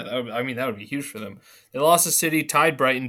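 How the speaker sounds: ordinary delivery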